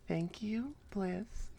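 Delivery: usual speaking voice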